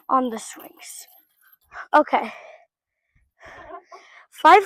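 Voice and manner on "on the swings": whisper. Real, not acted. Gasps, then drops to whisper.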